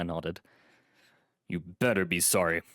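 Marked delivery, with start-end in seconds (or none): 1.5-2.6 mild, understated snap; snapping. A narrator's reading. Doorman warns the apologizing visitor, mildly snappish. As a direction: snap back with slight gruffness. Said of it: "he snapped".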